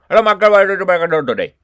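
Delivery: loud, fast imitation of a heavy Indian accent. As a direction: rattle it off loudly like a caller with a strong Indian accent. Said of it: "they explode my mind with their accent".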